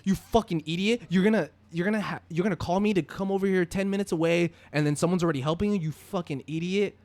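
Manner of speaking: loud, high angry shout, imitating the brother; yelling. Brother berating the man who called him over, yelling. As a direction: berate him at full volume, pitch up high. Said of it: "start yelling at me".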